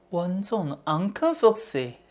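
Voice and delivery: slow, purring mock-seductive line; sly foxy pose. To the mirror, posing as a sly fox, mock-sultry. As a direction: play the sly fox for the camera.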